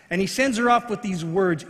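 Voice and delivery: usual speaking voice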